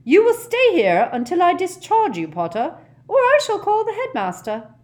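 high, loud, imperious threat; stern matron at full volume; threatening matron. A narrator's reading. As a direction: order the patient to stay put. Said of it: "a threatening manner".

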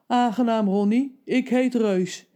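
a hint of a kind giant, slow at the end; lightly voiced giant. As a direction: greet the boy kindly. Giant introduces himself.

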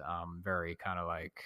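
ordinary talk, nothing marked

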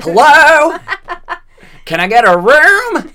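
high, loud yelled hello; hammed-up Cage hello. Nick Cage asked to just say hello, screaming it. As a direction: bellow a hotel greeting like a madman. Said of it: "can you just say hello".

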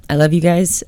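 ordinary talk, nothing marked

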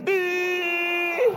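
high wailing sob, imitating the brother; mock the brother's sudden cry; imitated wail. Pastor imitates the crying brother's high wail. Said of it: "She said she will marry me".

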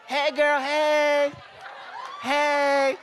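high, singsong mock girl voice; hammed-up girly greeting. Pastor mocking a girl's text to the congregation, high and singsong. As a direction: play a gushing friend saying hey.

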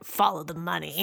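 lightly voiced low, gravelly informant; a hint of an ominous mystery man, with a laugh; deep gravelly voice. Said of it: "like, the deep gravelly voice".

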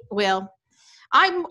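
usual speaking voice

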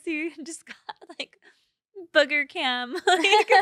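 loud, high laughter through the words; laughing tease. Real, not acted. Teasing her partner about the booger cam, loud laughter.

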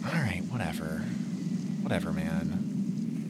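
very quiet, low, dragged-out shrug; resigned dismissal. Acted. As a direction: give up under your breath. Mimes giving up on the fight.

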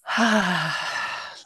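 long audible exhale; breathy meditation sigh; deep breath. Shown as a demonstration. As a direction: let out a deep, open sigh. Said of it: "So take a deep breath".

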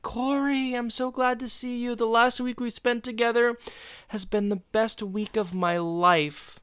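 put-on high, sweet girlish gushing; mock smitten girlfriend. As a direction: gush over him like a smitten girl. Voicing Wendy's gushing girl voice.

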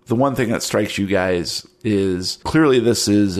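normal talking voice

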